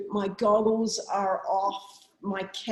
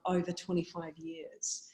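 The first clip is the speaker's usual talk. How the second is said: usual speaking voice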